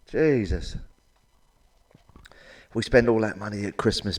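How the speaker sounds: ordinary delivery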